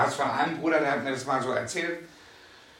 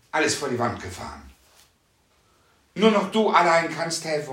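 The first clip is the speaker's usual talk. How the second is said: loud, pleading outcry; crying out to God; 2 semitones higher, 3 dB louder than usual. Retold or imitated. Preacher retelling the desperate prayer with his wife, a loud plea. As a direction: cry out in desperation. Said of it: "schrien wir".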